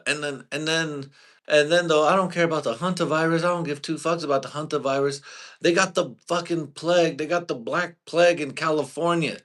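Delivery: high, loud, fast ranting about the plague; excited shouting rant. Real, not acted. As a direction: yell it out, all worked up.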